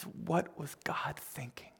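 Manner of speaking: hushed, slow, near-whispered retelling of tears; understated tearfulness, almost whispered; imitated tearful question. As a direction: softly voice her tearful disbelief. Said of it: "just in tears".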